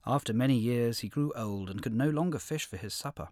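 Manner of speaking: plain reading voice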